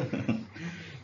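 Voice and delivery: quiet chuckle at own slip; a brief, breathy laugh to yourself; short soft laugh. Real, not acted. Teacher catches own slip saying स्वर्ग, soft chuckle.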